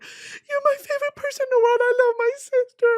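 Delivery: retelling a teary text to her sister; gushing to sister. Retold or imitated. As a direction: squeal it out like a tearful superfan. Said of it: "I texted my sister and I was like".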